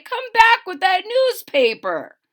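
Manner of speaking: high, loud call after the thieves; angry yell. A narrator's reading. The paper boy yells after the bunnies, loud and high. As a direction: chase them down with your voice. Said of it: "yelled the boy".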